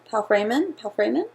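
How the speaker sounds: "Paul Freeman" tried twice, each rising in question; tentative, questioning tries at "Paul Freeman"; unsure pronunciation. Real, not acted. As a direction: test out "Paul Freeman" as "pal-FRAY-man", unsure. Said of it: "not sure how to pronounce it".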